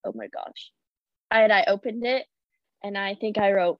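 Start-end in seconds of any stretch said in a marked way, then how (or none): 0.0-0.7 breathless whisper of disbelief; stunned whispered oh my gosh; whispered gasp. Retold or imitated. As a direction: whisper it like you can't believe it. Stunned reaction, retold.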